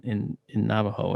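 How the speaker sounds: plain reading voice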